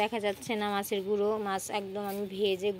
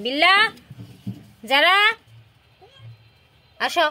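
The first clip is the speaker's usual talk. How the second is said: loud rising call; calling pets to eat; 10 semitones higher, 11 dB louder than usual. Real, not acted. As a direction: call the cats over from afar.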